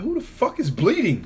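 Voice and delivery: high startled yell; shocked shout. Retold or imitated. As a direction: shriek it in shock. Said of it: "And I was like".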